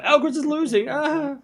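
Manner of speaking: high, squeaky panic for Mia; mock panic. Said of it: "Mia's freaking out. She's like".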